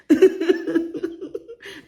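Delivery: high, loud real laugh; real high laughter at the Lamborghini joke; burst of laughter. Real, not acted. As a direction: laugh hard at your own punchline.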